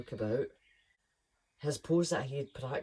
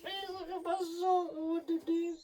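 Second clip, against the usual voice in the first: much higher, squeaky mock amazement. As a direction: squeak it out like a cartoon. Mock-exclaiming over the sock.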